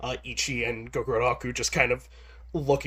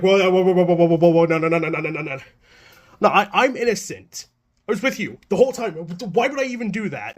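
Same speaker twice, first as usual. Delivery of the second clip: loud, rushed protest of innocence; panicked mock denial, louder and faster; flustered loud denial; 3 semitones higher, 4 dB louder than usual. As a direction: wave them off, then plead innocent fast. Des denying shoplifting.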